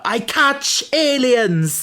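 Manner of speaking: high, drawn-out mock blurt; overplayed, long-stretched blurt; blurted outburst. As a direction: splurt it out as a high, slow imitation cry. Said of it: "she just splurts out".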